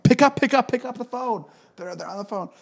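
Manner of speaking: very high, hushed urgent mom voice; excited mom. Retold or imitated. Mom urging child upstairs to pick up the phone, imitated high and urgent. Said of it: "'Cause my mom's like".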